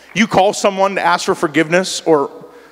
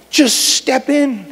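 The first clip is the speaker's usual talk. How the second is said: slow invitation; 6 semitones higher, much slower than usual. Real, not acted.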